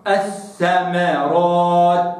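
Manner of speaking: الثمرات drawn out with a clean ث; slow recitation. Shown as a demonstration. Teacher reciting the example word slowly. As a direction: stretch الثمرات syllable by syllable. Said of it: "هكذا".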